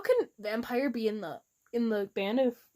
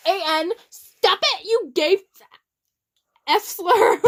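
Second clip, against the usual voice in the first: loud; 6 semitones higher, 6 dB louder than usual. Real, not acted.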